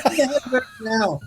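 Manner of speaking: high, insistent angry demand; acted irate father insisting; furious dad. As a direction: demand it in a fury. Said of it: "Oh, he was furious".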